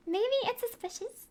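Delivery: usual speaking voice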